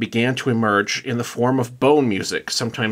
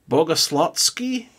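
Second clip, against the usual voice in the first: slow, careful Russian accent on Bogoslotsky; 6 semitones higher, slower than usual. Shown as a demonstration. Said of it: "You had the accent behind it".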